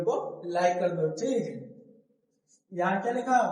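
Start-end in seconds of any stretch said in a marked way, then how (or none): none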